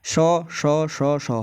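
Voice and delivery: सौ said with a held hiss; sibilant demo. Shown as a demonstration. Teacher asks students to try सौ, hissing it. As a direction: let the air hiss out on each सौ. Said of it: "इनको बोल के देखो".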